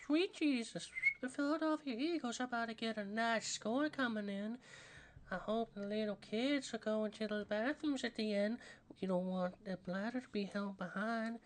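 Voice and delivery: high, soft cartoon voice with whistled S; Herbert. Streamer commentating on the game in character, high soft Herbert voice. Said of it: "I have not done a good Herbert voice".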